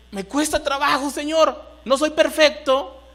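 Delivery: high, earnest mock prayer to the Lord; acted plea. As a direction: plead to God as a struggling husband. Husband's acted plea to God. (súplica actuada, en tono agudo)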